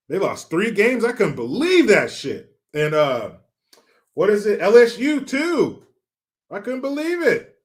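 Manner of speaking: high-pitched incredulous outburst; animated, astonished sports-fan rant; disbelief. Real, not acted. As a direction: exclaim it, voice jumping up in disbelief. Amazed at a team's losses.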